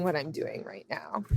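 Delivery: drops to a breathy whisper. Real, not acted. Guest trailing off to a whisper about her career choice. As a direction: let the last words fall to a whisper. Whispered end of emotional claim.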